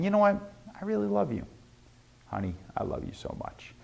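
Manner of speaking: soft, close, dropping to a breathy near-whisper; tender and sincere. Shown as a demonstration. Telling your girlfriend you love her, softly and tenderly. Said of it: "If you could say it real".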